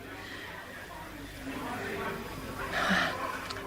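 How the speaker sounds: long, breathy tired sigh; drawn-out sigh of resignation; weary sigh. Acted. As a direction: let out a heavy sigh. Weary pause recalling Max.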